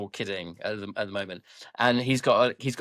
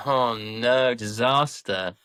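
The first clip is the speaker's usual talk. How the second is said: sarcastic; 2 semitones lower, much slower than usual. Real, not acted. Co-host reacting to hay-spoiled gift, dryly sarcastic. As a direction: feign concern, slow on disaster. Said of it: "[name], was that sarcasm?".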